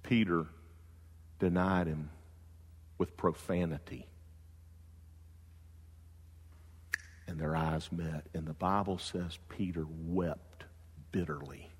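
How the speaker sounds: hushed sermon voice; hushed preaching. Acted. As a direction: let the words sink in quietly. Hushed solemn preaching on denial.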